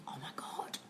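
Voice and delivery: near-whispered, sinking realisation; dismayed, voiceless aside; hushed dismay. Retold or imitated. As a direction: gasp it under your breath, very softly. Acted dismay on reading a text.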